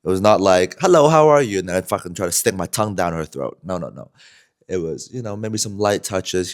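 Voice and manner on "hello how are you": higher, louder, overly polite hello; mock polite greeting. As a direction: greet her in a fake-nice tone. Mock polite opener before groping.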